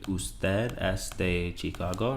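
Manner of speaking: drawn out with a rising end; slow demo question. Shown as a demonstration. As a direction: ask it slowly for the learner. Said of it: "If I ask you".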